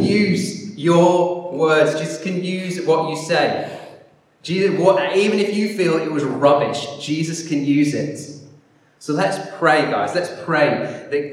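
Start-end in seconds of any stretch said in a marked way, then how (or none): none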